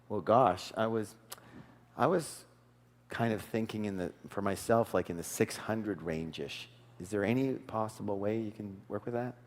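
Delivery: downplay the offer so nobody feels pushed; soft and humble. Shown as a demonstration. Coach showing the buyer's humble counteroffer, soft and low. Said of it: "listen to the way I do it".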